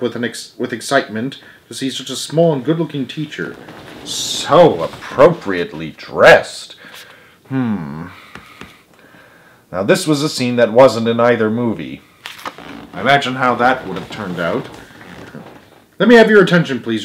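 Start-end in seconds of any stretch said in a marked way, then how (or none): 4.0-8.1 slow, dry comment under the breath; wry aside. Real, not acted. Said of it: "reader's aside". 16.0-17.0 raised teacher voice, said again; repeating the call to order. A narrator's reading. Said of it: "repeated Stuart".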